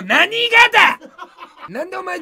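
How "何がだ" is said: mock snap. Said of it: "切れる生配信".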